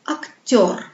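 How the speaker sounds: "acteur" with the last syllable stressed and drawn out; exaggerated final stress in "acteur". Shown as a demonstration. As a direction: lean on the end of "acteur", falling. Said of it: "L'accent tombe sur le son yo".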